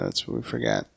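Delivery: ordinary talk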